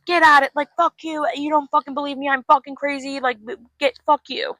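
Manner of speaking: high-pitched angry tirade; furious outburst. Retold or imitated. Heroine cursing out Knight at her door, furious. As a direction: act out screaming him away from the door.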